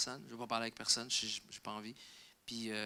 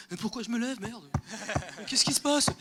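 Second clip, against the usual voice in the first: high, loud bewildered asking; baffled audience; 9 semitones higher, 3 dB louder than usual. Retold or imitated. Audience baffled to be standing, high bewildered cry. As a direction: play a confused crowd, voice pitched way up. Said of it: "Même eux étaient comme".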